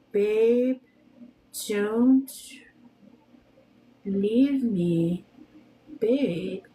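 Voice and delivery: long pauses between the words; deliberate, evenly spaced dictation. Shown as a demonstration. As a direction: say it slowly, one word at a time. Spells out the clip for searching.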